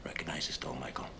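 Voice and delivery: hushed, barely voiced question; whispering. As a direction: whisper it close to him. Quiet interview with suspect.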